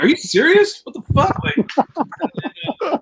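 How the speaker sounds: can't believe what he hears; incredulous. Retold or imitated. Reacting to Eddie's illegal cable, incredulous.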